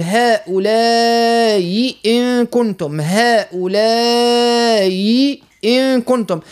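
first hamza of "هؤلاء" eased, the hamza of "إن" pronounced in full, slow; Qalun's reading. Shown as a demonstration. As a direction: read "هؤلاء إن كنتم" with the first hamza softened. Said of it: "قالون يسهل الهمزة الأولى مع القصر". (تسهيل الهمزة الأولى في "هؤلاء" وتحقيق همزة "إن" ببطء)

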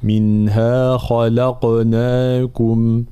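"منها خلقناكم" with a heavy خ, a thin ل, and a heavy, echoing ق; drawn out slowly, letter by letter; tajweed demo. Shown as a demonstration. Teacher modeling the verse for students, slow and precise. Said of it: "ثم القاف مفخمة مقلقلة".

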